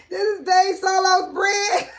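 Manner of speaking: real tearful laughter; laughing cry. Real, not acted. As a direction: wail it while cracking up. Laughing while showing bread.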